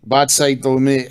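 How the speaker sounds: ordinary talk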